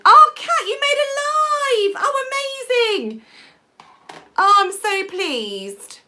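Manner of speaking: high, loud squeal of delight, slowing on the words; thrilled greeting to a viewer who made it live; excited delight. Real, not acted. Viewer comment from Kat arrives.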